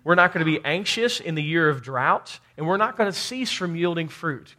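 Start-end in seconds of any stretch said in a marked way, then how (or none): none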